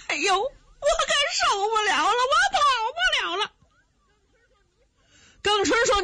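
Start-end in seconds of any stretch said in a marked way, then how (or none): 0.1-3.5 high, wailing complaint of an exhausted man; whining yell. Acted. Worn-out Zhao shouts to Geng father and son, high and whining. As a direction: cry out in a high whine that you can't go on. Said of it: "就冲着耿家父子直嚷".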